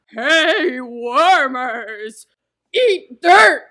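loud, high, put-on blustering voice; Cowardly Lion. Player performing a movie quote for the host, Cowardly Lion voice. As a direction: bellow the taunt as a cowardly lion. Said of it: "in your best Cowardly Lion".

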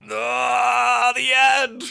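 high, loud wail to close the tale; mock anguished cry, then "the end"; dramatic finale. Dramatic ending to story told.

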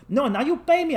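high-pitched pushy demand; insistent dealer. Retold or imitated. Dealer insists the tourist pay, high and pushy. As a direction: imitate a dealer who won't back off.

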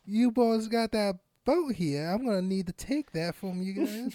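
a hint of a Southern lawman voice; mild mock Southern repo man; Southern accent. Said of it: "Southern Western".

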